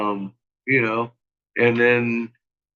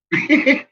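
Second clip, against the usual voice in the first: laugh; 14 semitones higher, 3 dB louder than usual. Real, not acted. Laughing at trolls shut down.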